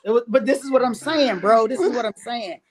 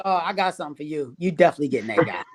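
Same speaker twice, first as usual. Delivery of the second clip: imitating Jacob; 7 semitones lower, faster than usual. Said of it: "I feel like he's like".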